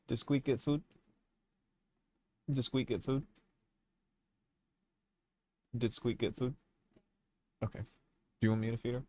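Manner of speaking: hushed question to family; quiet question. Real, not acted.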